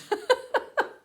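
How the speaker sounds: short rising laugh; high delighted laugh. Real, not acted. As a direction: laugh in disbelief. Laughing at more found money.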